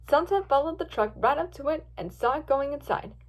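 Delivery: ordinary delivery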